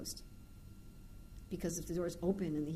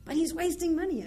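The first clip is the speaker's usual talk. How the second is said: raised, upset protest; indignant; 10 semitones higher, 7 dB louder than usual. Retold or imitated. Devotee protesting to the speaker about her husband, indignant and raised. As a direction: push back louder and higher. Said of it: "Why are you so disturbed".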